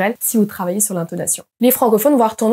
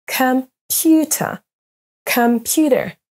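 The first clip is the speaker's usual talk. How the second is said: "Computer" as com-PU-ter; second-syllable stress; 3 semitones higher, much slower than usual. Shown as a demonstration. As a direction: stretch PU in "Computer", let the end fall. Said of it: "on a la même chose pour".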